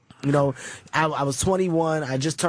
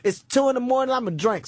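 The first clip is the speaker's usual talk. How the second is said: put-on drunk pleading; 6 semitones higher than usual. Drunk younger self.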